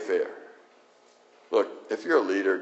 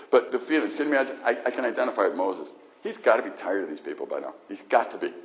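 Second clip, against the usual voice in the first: ordinary delivery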